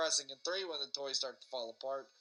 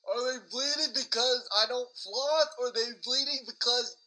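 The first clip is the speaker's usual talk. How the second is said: louder and higher, fired back in outrage; indignant retort; 8 semitones higher, 7 dB louder than usual. Retold or imitated. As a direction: throw the blame right back at him. Arguing back at the dentist.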